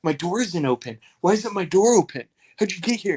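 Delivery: high, fast, bewildered questioning; high, quick confused inmate; confused alarm. Retold or imitated. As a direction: sound rattled and baffled, voice up high.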